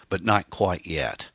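slow, menacing afterthought; spat threat. A narrator's reading. Killer inches from Anne's face, slowly menacing. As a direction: draw it out with contained menace. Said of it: "he spat".

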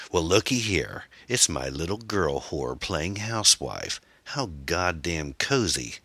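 slow, drawn-out mock delight; menacing gunman toying with his victim; taunting villain. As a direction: drawl it out, relishing the taunt.

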